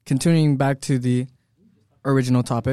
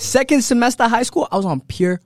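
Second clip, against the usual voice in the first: excited; 9 semitones higher, 9 dB louder than usual. Real, not acted. Hyped storytelling, loud and high.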